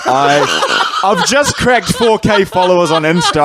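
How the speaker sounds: high, nervous laugh through the words; panicked laughing. Real, not acted. Panicked laughing at own gaffe.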